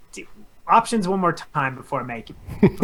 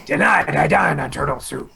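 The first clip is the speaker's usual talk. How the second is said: a lightly voiced, badly done Shredder line; James Avery impression; 3 semitones lower than usual. Host quizzing friends, botched James Avery Shredder voice. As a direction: try a villain's menace and miss. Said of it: "That was a terrible James Avery".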